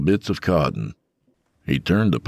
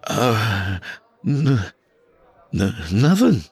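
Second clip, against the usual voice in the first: stammering, higher frightened reply; trembling voice; 5 semitones higher, slower than usual. Acted. Tony answers the armed killer, stammering scared. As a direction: stutter it out in fear. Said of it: "Tony's voice trembled".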